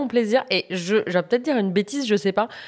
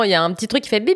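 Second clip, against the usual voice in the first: ordinary talk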